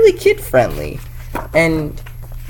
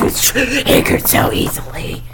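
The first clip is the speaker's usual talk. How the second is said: snide whisper; 9 semitones higher, 3 dB quieter than usual. Pretend. Snide aside about feminists.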